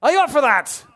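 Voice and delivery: shouted, high Scottish rallying cry; rally the troops in a put-on Scottish accent; loud mock-Scottish battle call; Braveheart Scottish accent. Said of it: "a Braveheart kind of Scottish accent".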